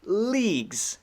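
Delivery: LEAGUES with a long, drawn-out ee. Shown as a demonstration. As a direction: stretch the ee in Leagues, high then falling. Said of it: "Long E".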